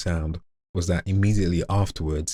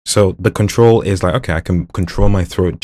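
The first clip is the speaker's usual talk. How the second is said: usual speaking voice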